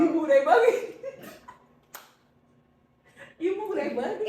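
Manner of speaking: high laughing suggestion. Real, not acted. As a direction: say it cracking up.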